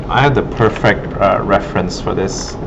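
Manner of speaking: ordinary delivery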